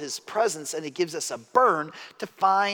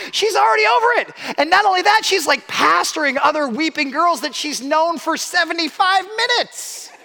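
much higher and louder, bursting with pride; excited marvel; 10 dB louder than usual. Real, not acted. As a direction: gush about her like you can't believe it. Proud parent marvels at daughter.

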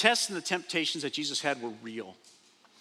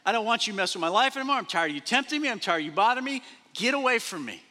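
angry rebuke; 6 semitones higher, faster than usual. Acted. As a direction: drive the tempter off, fed up. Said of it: "Satan said".

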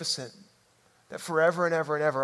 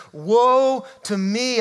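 loud, high, drawn-out cry; 7 semitones higher, 8 dB louder, much slower than usual. Acted. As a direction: cry it out slowly and loudly. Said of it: "I cried".

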